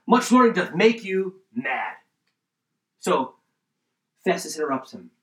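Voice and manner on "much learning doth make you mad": loud, raised, stately interruption. Acted. Preacher acting Festus interrupting Paul, loud voice. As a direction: cut in loudly with a high, forceful rebuke. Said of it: "Festus said with a loud voice".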